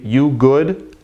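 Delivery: "You good" with GOOD rising; question. Shown as a demonstration.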